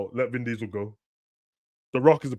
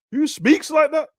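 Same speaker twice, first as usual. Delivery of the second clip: incredulous squeak; 5 dB louder, slower than usual. Real, not acted. Mocking her line delivery.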